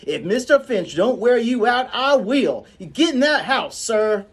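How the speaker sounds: high, loud scolding housekeeper; scolding Calpurnia. Acted. Calpurnia scolding the children on the way home. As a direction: tear into the children. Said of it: "her classic".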